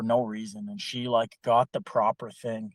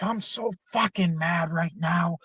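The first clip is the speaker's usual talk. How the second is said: higher mock tantrum, childish whine; imitating angry tantrum; 6 semitones higher than usual. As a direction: act out the boss throwing a childish tantrum. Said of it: "Like, yelling at this employee".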